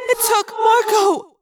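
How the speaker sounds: put-on high, loud stricken girl; Cassie's voice. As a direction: say it high and shaken. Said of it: "Cassie said again".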